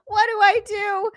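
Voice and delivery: high, slow fake sob; mock crying. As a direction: whimper it, overwhelmed.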